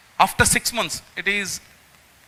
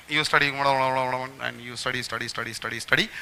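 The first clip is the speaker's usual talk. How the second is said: monotonous droning repetition; 6 semitones lower, 7 dB quieter than usual. Shown as a demonstration. Mocking endless blank studying.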